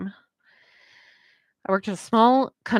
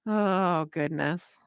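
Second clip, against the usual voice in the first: usual speaking voice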